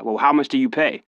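lightly put-on Cali rapper asking; fake-American deal maker; a hint of American swagger; cocky American accent. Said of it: "it kinda went something like this".

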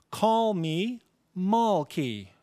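"call me mall key" spoken very slowly, sounds swapped; jumbled syllables. Shown as a demonstration. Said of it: "For example".

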